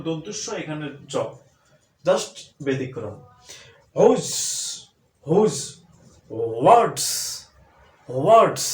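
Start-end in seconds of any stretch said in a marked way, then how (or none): 4.0-8.7 loud "হুজ" and "ওয়ার্ডস", each ending in z; drilling the class on these words; who's, words. Shown as a demonstration. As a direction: hit "হুজ" and "ওয়ার্ডস" with a buzzing z. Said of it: "কি উচ্চারণ হলো?".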